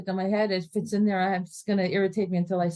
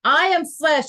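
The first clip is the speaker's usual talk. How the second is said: louder and higher on I am flesh; loud defiant declaration; 5 semitones higher, 6 dB louder, slower than usual. Shown as a demonstration. Teacher shouting the line at the Yetzer Hara defiantly. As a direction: proclaim I am flesh with force. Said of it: "repeating the line, louder".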